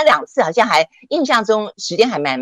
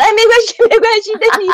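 much higher, louder cheerful calling out; cheerful reassurance; 4 dB louder than usual. Retold or imitated. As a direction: call after her brightly, no worries. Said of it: "professor calls out as she retreats". (热情地喊)

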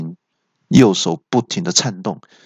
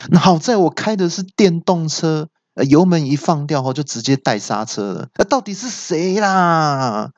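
exasperated complaint; 6 semitones higher than usual. A narrator's reading. 恶怪被打断而抱怨.

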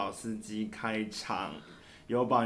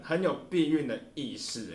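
ordinary delivery

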